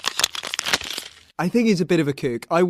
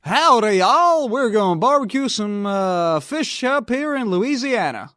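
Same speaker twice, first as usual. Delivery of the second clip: put-on failed Canadian accent; 5 semitones higher, 4 dB louder than usual. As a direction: ham up a cowboy Southern accent by mistake. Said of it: "Can you do a good Canadian accent?".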